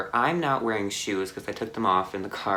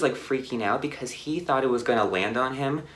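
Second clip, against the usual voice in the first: ordinary delivery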